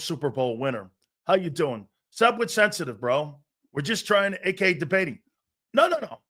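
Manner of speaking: ordinary talk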